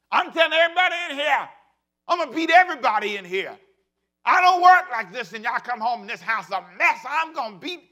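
high, loud, furious mock tirade; a mother shouting at the kids over the messy house; angry parent shout. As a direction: yell it like a parent who found the house a mess. Preacher mimics a shouting parent.

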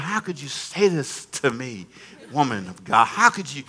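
hushed, low mock-wounded protest; retold hurt disbelief. Young husband silently reproaching his wife, low hurt disbelief. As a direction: protest under your breath, slightly hurt. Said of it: "I just thought".

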